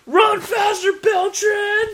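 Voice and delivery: high, loud heckler yell; mock shouted instructions. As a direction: imitate a fan hollering at players. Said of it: "the ones shouting instructions at players".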